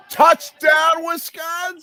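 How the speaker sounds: very high, loud celebratory call; touchdown shout. Real, not acted. Fan watching Wisconsin score, shouting excitedly. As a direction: yell it out like a real touchdown call.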